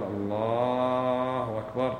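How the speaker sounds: الله أكبر stretched very slow, vowels pulled long; wrong drawn-out takbir. Shown as a demonstration. Teacher demonstrating a wrong takbir, very slow and drawn out. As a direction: drag out الله أكبر to show the mistake. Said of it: "هذا خطأ".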